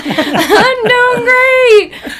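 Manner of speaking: very high and loud, drawn way out; hammed-up singsong insistence, laughing at yourself; fake cheery squeal. Caught-out client assuring the officer all is well, hammed-up cheery squeal.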